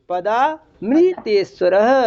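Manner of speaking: "पदामृतेश्वरः" as padaa, mri-teshvarah, very slow; split before मृ. Shown as a demonstration. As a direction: hold padaa, then begin a clear mri in "पदामृतेश्वरः". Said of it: "पदाम नहीं". ("पदामृतेश्वरः" को पदा-मृ-तेश्वरः, धीरे)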